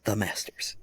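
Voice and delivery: hushed golf announcer whisper; golf commentator. Shown as a demonstration. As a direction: breathe "the Masters" like a TV golf broadcast. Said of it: "gotta say it in the golf voice".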